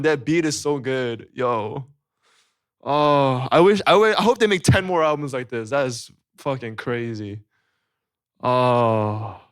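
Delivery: usual speaking voice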